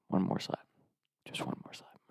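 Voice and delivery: very soft, sneaky whisper; conspiratorial whisper. Retold or imitated. Pranksters agree on a last slap at night, sneaky whisper. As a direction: plot under your breath.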